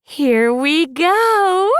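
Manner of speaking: put-on a giddy girl relishing the moment; Cupid. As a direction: savor it with delight, drawn out. Cupid anticipates.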